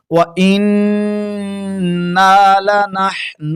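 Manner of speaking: slow, drawn-out melodic tilawat; Quran recitation. Acted. Recites with long madd. (ترتیل کے ساتھ تلاوت)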